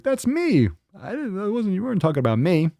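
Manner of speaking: high-pitched mock protest. Host mimics fans thinking "fat girl" meant them, high mock protest. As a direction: squeal it high like an indignant listener. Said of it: "Everyone's like".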